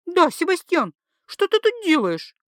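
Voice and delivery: wife turns on her husband; confronting wife. A narrator's reading. (жена допрашивает мужа)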